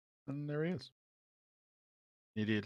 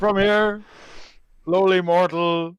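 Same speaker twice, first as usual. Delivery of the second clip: high, loud and grand; lofty voice; 8 semitones higher, 6 dB louder, slower than usual. Acted. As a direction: command like a haughty deity. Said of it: "I'm just gonna put on the lofty voice".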